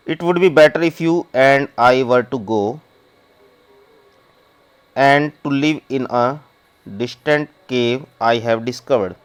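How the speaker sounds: reads the English very slowly, word by word; slow story reading. Acted. Teacher reading the story text to the class, slow and deliberate. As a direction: spell the story out slowly for students.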